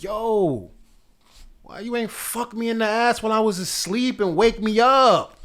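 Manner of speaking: slow, high-pitched impression of an aggressive demand; imitated aggressive demand. Man mimicking his ex on waking, aggressive. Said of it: "real aggressive about it".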